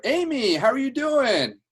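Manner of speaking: very high, loud, stretched-out greeting; thrilled to hear an old friend; best-friend energy. Shown as a demonstration. Manager greets a stranger like a long-lost friend, big excited hello. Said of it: "a certain type of energy that he had".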